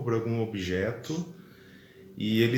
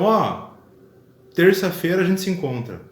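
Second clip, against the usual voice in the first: lightly acted invitation, louder and higher; calling to a friend; 6 semitones higher, 7 dB louder than usual. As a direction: propose a meet-up to a pal, voice lifted. Acts out second invitation to friend.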